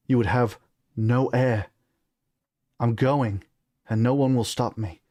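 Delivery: put-on unyielding British-accented prince; deliberate, resolute British delivery. Son defies the king, British accent. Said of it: "on the British accent".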